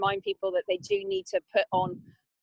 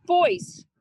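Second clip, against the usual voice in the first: raised voice to get attention; calling out; 8 semitones higher, 8 dB louder than usual. Real, not acted. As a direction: call across the room. Calling out to the team.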